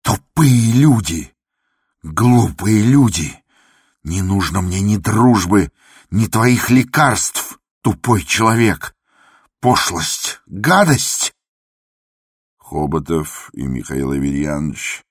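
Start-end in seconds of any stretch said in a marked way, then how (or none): 0.0-11.3 loud, high, slow tirade; enraged denunciation, words stretched; raging shouts. A narrator's reading. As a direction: hurl each insult with fury. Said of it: "продолжал кричать Андрей Ефимович".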